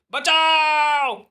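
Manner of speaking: very high, long drawn-out infant cry; baby wail. Acted. Man's cry for help heard as a newborn's wail. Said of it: "बच्चे के रोने का स्वर".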